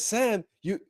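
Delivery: drawn-out protest, voice lifted high; high, slow mock Frodo pleading; worried Frodo. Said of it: "and Frodo says".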